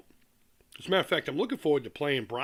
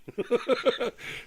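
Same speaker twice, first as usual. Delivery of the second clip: high amused chuckle; 9 semitones higher than usual. Real, not acted. Laughs after calling him shady.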